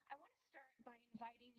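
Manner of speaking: hard to hear, far from the mic; barely audible. Real, not acted. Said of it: "I think your voice is very low".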